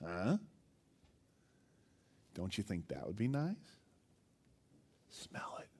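sly serpent coaxing, ending in a whisper; tempting serpent. Retold or imitated. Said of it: "He's like".

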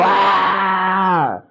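high, held war yell; Braveheart battle cry. Acted. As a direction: imitate a charging warrior's cry. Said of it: "Like a Braveheart kind of thing".

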